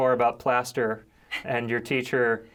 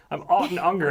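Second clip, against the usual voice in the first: put-on playful Cockney accent, higher, H's dropped; mock Cockney; 4 semitones higher, faster than usual. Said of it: "starting to sound like a Cockney".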